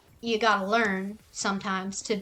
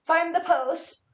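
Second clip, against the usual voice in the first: slow, drawn-out voice of a pushy fan asking for a post; mock demanding fan; 3 semitones higher, slower than usual. Fan pestering her to dig up an old post, in a slow mocking voice. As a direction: put on a slightly whiny requester voice. Someone demanding she find tags.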